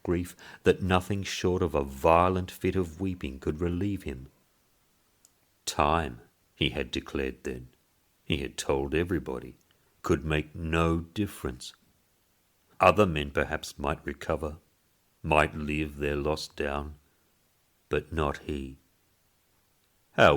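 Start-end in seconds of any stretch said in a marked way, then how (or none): none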